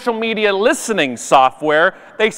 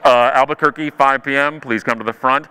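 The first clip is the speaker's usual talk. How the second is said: low, droning airport page; intercom announcer; 7 semitones lower than usual. Retold or imitated. Airport intercom calling late passengers to the front. Imitating intercom gate call.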